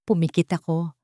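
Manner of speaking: usual speaking voice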